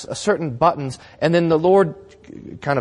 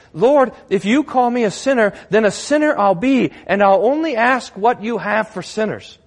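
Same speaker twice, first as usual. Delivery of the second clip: raised, earnest address to God; sinner's bold prayer; 7 semitones higher, 3 dB louder than usual. Acted. Sinner accepting the Lord's verdict, earnest bold prayer. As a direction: pitch it up and accept it boldly.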